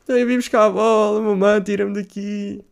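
mock pleading little boy; whiny kid plea. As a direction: beg mum to get you out. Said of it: "e eu".

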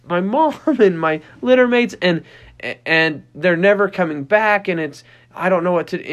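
high, whimpering puppy voice; anxious whining puppy. Retold or imitated. Puppy alone in the kennel whining for mom, high and fretful. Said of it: "they're just like".

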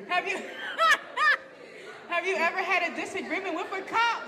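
asking through giggles; laughing. Real, not acted. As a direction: hold back laughter while asking.

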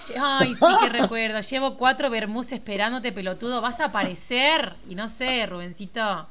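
playful Argentine scolding; porteño accent, teasing; mock Argentine. Host answering the joke voice notes in a mock Argentine accent. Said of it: "Lo haces re bien".

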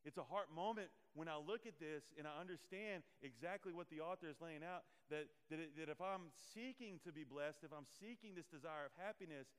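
ordinary talk, nothing marked

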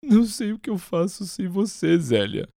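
high, tearful plea; crying. Acted. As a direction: cry it out to her.

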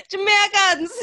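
very high, drawn-out mock royal voice; slow, squeaky put-on call, cracking up; the Queen. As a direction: mimic a royal through laughter. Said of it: "Say that's not the Queen".

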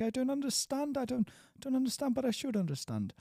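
high-pitched mock whine of a confused learner; frustrated learner. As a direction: play the stuck student who can't get it. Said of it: "'cause they feel like".

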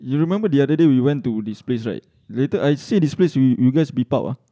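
normal talking voice

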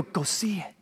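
breathless whispered recommendation; soft awed hush; hushed excited whisper. Retold or imitated. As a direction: whisper it like a secret. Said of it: "you know what we say? We go".

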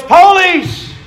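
very high, loud cry of alarm; yelled warning. Retold or imitated. Gang member warns friends in retold story, yelling. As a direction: yell it to scatter the gang. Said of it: "one of them yells".

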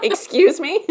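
mock offended. Joking shock about finding self-love easy, laughing. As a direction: feign outrage through laughter.

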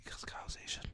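very soft unvoiced whisper. Real, not acted. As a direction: whisper it like a secret. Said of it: "Why are we whispering?".

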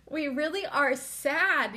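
real tearful break on the podcast. Real, not acted. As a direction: fight back the tears as the voice cracks. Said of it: "I'm so sad".

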